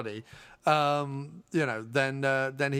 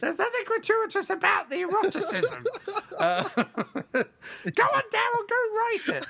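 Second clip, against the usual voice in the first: very high falsetto through laughter; laughing mock falsetto mother; ghost mother voice. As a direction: squeak it out, cracking up.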